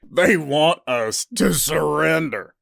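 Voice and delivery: loud, gruff mocking human; gruff accented voice. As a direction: call out gruffly, amused. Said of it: "a gruff, accented voice echoed".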